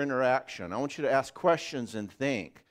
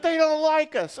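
put-on high, loud little-kid wail; hammed-up child's cry of rejection; upset five-year-old; 13 semitones higher, 8 dB louder than usual. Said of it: "Five-year-old is saying that".

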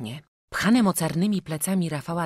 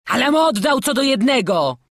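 loud, high self-defence; pleading, raised voice; protesting prisoner; 10 semitones higher, 9 dB louder than usual. A narrator's reading. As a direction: object indignantly.

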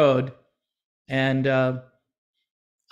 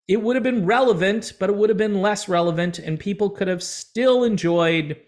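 ordinary talk

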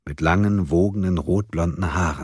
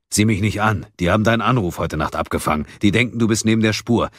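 quick, insistent explanation; lightly voiced Munk, defensive; 4 semitones higher, 4 dB louder, faster than usual. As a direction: fend off blame, rattling out the news. Munk relays the warning in dialogue. (leicht abwehrend, schnell und eindringlich)

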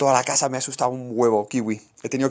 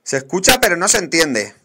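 raised, higher voice; loud; 5 semitones higher, 8 dB louder than usual. Real, not acted. Answering viewers about the sound test, loud. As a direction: call it out loudly. Reading chat, shouting back.

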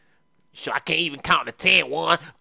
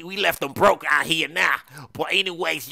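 usual speaking voice

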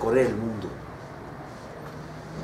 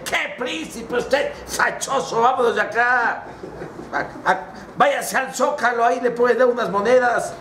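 high, mocking dismissal; acted derisive gatekeeper; scornful guard; 7 semitones higher than usual. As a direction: scoff and wave the beggar away, pitched high. Guard mocks and dismisses prince.

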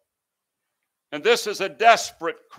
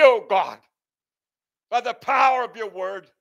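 high, ringing preacher's plea; impassioned prayer; 6 semitones higher, 4 dB louder than usual. Acted. Preacher voices the psalmist calling on the creator God, fervent. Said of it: "I'm crying out to the God".

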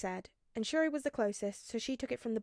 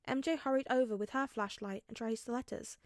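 ordinary delivery, nothing marked

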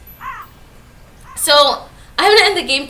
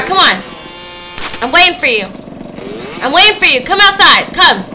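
loud taunting calls; 2 semitones higher, 5 dB louder than usual. Real, not acted. Gamer taunting the crazy lady, loud. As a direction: shout it out, daring her to come.

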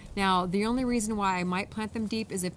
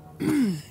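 throat-clearing cough; 5 semitones higher, 5 dB louder than usual. Real, not acted. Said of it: "I think I swallowed a, a bug".

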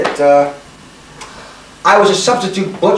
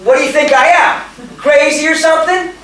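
repeated louder; 10 semitones higher than usual. Acted. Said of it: "I said".

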